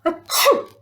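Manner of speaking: high mock sneeze achoo. Shown as a demonstration. Teacher sneezes for the class, mock sneeze. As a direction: fake a big sneeze. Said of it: "and sneeze".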